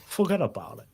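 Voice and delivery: half-hearted New York accent, tossed off softly; badly faked New York accent. Shown as a demonstration. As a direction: try a bad New York wiseguy accent. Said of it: "Can you do a New York accent?".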